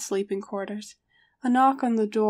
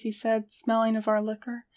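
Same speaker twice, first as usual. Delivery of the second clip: plain reading voice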